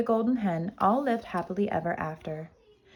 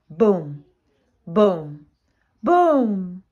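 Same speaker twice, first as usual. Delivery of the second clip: stamp each boom out like footsteps; 6 dB louder, much slower than usual. Acted. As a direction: giant footsteps shaking the room. Giant's footsteps approaching.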